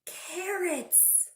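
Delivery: ordinary delivery, nothing marked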